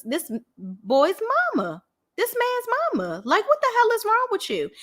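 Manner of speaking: high-pitched, exasperated telling-off; incredulous scolding. Retold or imitated. Host scolds Portia in imagined address.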